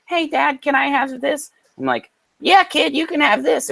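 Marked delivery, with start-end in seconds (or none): 0.1-1.5 put-on high kid voice asking dad; imitating son. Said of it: "every time my son speaks". 2.4-3.7 dad answering in a very high squeaky voice; reply up high, copying the kid; dad mockingly answers son in high voice; mimicking son's voice back. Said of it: "I suck at doing voices".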